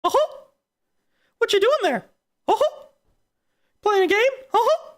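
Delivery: very high falsetto cartoon mouse; Mickey. Mouse asks streamer about playing a game, squeaky falsetto. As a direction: squeak it with perky little chuckles. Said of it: "Gosh, Mickey".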